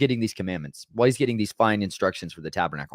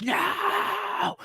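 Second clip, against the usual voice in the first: high cry of rage as the tablets fall; anguished shout. Acted. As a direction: scream it out, heartbroken and furious. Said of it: "and he's like".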